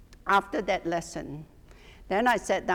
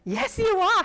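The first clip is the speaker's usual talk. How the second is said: high, puzzled "Yes, you are."; bemused reply; 12 semitones higher, 6 dB louder than usual. Retold or imitated. Answering the unknown visitor, high and puzzled. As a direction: humor the stranger, pitch up in confusion. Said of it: "And I was like".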